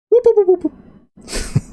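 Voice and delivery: high whooping effect that fades out; whooping sound effect. Shown as a demonstration. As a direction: swoop the pitch way up and let it die away. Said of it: "GM makes dodge sound effect".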